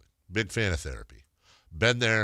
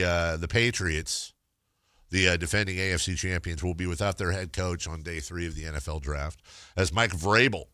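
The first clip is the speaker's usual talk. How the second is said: ordinary talk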